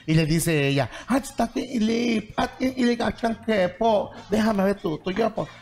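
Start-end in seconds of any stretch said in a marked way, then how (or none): 1.1-5.5 high, drawn-out wifely pleading; wheedling plea. Acted. Wife begging her husband to let her look, wheedling. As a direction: coax in a higher, stretched-out voice.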